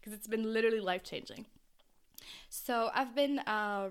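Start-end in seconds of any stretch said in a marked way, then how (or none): 0.0-1.5 rushed, excited fast talk; words tumbling out in excitement. Real, not acted. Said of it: "Sorry, I'm talking so fast".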